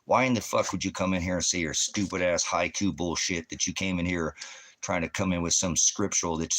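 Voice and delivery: normal talking voice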